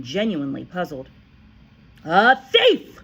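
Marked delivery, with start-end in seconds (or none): 2.0-3.0 loud, much higher scream; screaming headmistress. A narrator's reading. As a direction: scream the accusation. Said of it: "the Trunchbull screamed".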